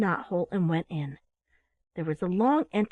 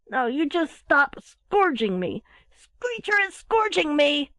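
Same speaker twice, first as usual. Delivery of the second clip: high, drawn-out whine of protest; sleepy whine; 7 semitones higher, 5 dB louder, slower than usual. A narrator's reading. Little owl complaining in its sleep, sleepy whine. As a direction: whine it slowly and loudly, half asleep. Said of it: "a sleepy, whining voice".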